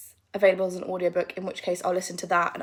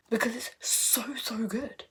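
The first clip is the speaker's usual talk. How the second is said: whisper; 3 semitones higher, slower than usual. Real, not acted. Gushing about the book.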